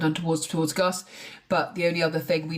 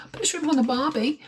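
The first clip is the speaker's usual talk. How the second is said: mock Australian accent; 7 semitones higher than usual. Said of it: "in a normal accent".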